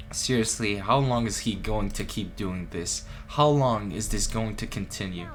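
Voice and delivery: ordinary talk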